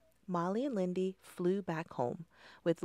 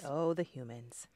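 soft, low whisper; hushed, lower aside; 5 semitones lower, 8 dB quieter than usual. A narrator's reading. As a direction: lean in and whisper ominously.

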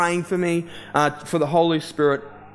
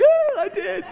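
very high, squeaky imitated cry; hammed-up weepy squeal; mock tearful wail. Son answers his mum at the door, in a mock wail. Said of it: "And I just cried".

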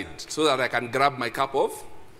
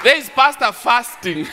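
loud, higher mocking call-out; mock shout; 8 semitones higher, 8 dB louder than usual. Church friend teasing the fasting pastor, mock shout. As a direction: point him out teasingly to the room. Mock ROG guy calling out.

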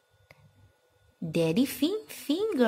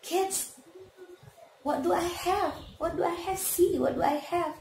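kindergarten teacher voice; 8 semitones higher than usual. Acted. Teacher quizzing toddlers.

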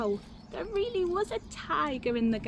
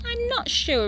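put-on high wavering old-lady voice; a gently teasing grandma; doubtful Grandma; 3 dB louder than usual. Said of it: "replies Grandma".

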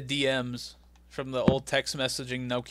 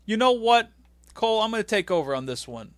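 imitating Taz; 6 semitones higher than usual. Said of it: "And Taz like".

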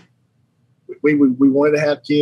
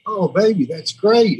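happy reply; 7 semitones higher, slower than usual. Retold or imitated. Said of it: "And I just said".

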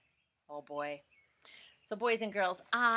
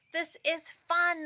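much higher, louder gleeful cry; excited animals; 10 semitones higher, 7 dB louder than usual. Acted. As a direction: cheer like thrilled farm animals. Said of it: "they all said".

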